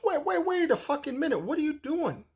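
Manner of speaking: high, urgent cry of wait, wait; a hammed-up high squawk from the old owner; mock outraged old man. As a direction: imitate the boss stopping him mid-bite. Old owner stops him eating.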